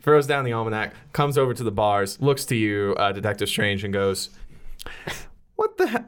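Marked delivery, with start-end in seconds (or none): 5.6-6.0 high-pitched startled exclamation. Acted. Prisoner seeing the detective at her bars, startled. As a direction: blurt it high, in disbelief.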